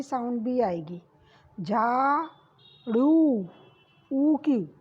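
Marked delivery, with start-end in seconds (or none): 1.6-3.5 झाड़ू with the ऊ stretched slowly; long drawn oo. Shown as a demonstration. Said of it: "ऊ की मात्रा की साउंड ऊ जैसी ही आती है". (ऊ को लंबा खींचकर झाड़ू)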